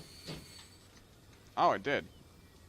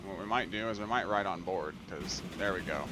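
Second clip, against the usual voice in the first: normal talking voice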